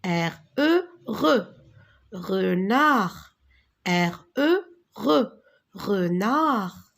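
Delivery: R, E, "re", then the whole word "Renard"; "Renard" ending on the R sound, no D; syllable breakdown. Shown as a demonstration. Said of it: "Tu peux répéter avec moi".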